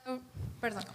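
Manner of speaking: usual speaking voice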